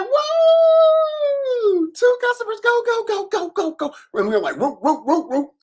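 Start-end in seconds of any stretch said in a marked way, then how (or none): 0.0-3.9 very high, loud whooping cheer; mom cheering. Retold or imitated. Mom cheering her son's two customers, very high whoop. 4.6-5.5 family whooping. Retold or imitated. Family cheering together.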